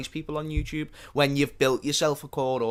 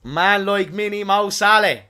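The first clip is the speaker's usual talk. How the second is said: hyped reveal; 6 semitones higher, 8 dB louder, slower than usual. Real, not acted. Proudly unveiling a Mo Salah figurine.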